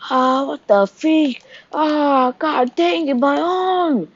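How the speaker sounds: high, slow pained cry; screaming in pain. Retold or imitated. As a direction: cry out as his arm is torn off. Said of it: "He's screaming out in pain".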